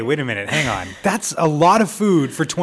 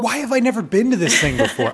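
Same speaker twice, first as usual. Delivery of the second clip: much higher and louder, genuinely amazed; amazed outburst; 9 semitones higher, 4 dB louder than usual. Real, not acted. Radio host amazed she has missed the food festival, excited. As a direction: let it jump up in sheer disbelief.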